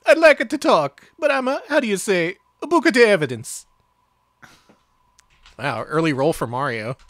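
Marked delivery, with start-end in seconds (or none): 0.0-3.7 high, loud cartoon Italian accent; heavy Italian accent. Players voicing Officer Gillespie, heavy Italian accent. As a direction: lay on a thick mock-Italian accent. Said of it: "In his heavy Italian accent".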